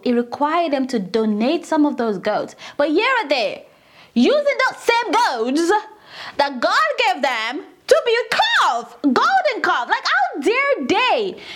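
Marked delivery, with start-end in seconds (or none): 4.1-11.4 indignant. Real, not acted. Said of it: "makes me extremely angry".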